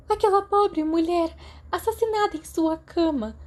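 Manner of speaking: a distraught student reading bad news; tearful. A narrator's reading. (chorando, aflita)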